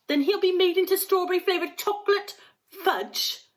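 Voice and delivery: high, loud horrified cry; mother screaming at Wonka about her son. A narrator's reading. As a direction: scream in disbelief. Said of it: "screamed Missus Gloop".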